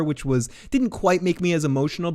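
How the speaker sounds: ordinary talk, nothing marked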